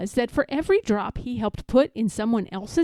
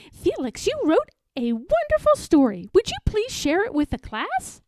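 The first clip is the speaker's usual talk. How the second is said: praising; 8 semitones higher than usual. A narrator's reading. Teacher praising Felix.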